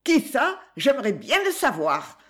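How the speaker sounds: high, pert snap back; almost insolent retort. A narrator's reading. Woman retorting insolently to the lady. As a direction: answer back with near-insolence. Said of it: "rétorqua-t-elle presque insolemment".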